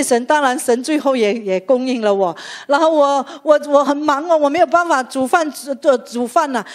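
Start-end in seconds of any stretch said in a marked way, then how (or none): none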